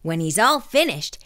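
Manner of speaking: ordinary delivery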